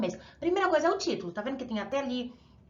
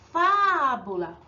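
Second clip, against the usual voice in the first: fábula slow, with FÁ long and loud; stronger stressed syllable; 5 dB louder, much slower than usual. Shown as a demonstration. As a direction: stretch the FÁ in "fábula", then let it fall. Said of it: "o acento é a mais forte".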